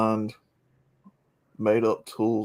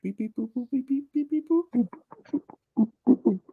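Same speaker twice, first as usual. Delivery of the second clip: high beeping robot noises; toy robot voice; 14 semitones higher than usual. Pretend. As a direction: beep and boop like a little robot. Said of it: "I apologize, K-Plan".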